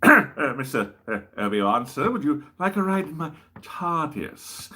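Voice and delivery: lightly voiced, hesitant Second Doctor; a stammering Doctor, stretching out the invitation. Doctor offers Obi-Wan a ride in his tardis, in a hesitant Second Doctor voice. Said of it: "The second Doctor met Obi-Wan".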